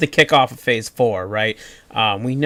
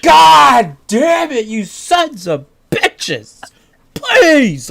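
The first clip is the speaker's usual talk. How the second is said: much higher, louder, slow wailing curse; anguished fan cry; 13 semitones higher, 7 dB louder, much slower than usual. Retold or imitated. Fan wailing at the showrunners in anguish. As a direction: wail it out in frustrated agony. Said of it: "I'm like".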